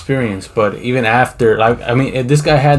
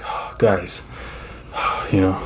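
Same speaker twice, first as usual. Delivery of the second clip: soft, low, sighing delivery; mock somber; 4 semitones lower, 7 dB quieter, slower than usual. Livestreamer to his viewers, feigned sorrow. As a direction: put on heavy-hearted gravity for the camera. Acting out somber act for camera.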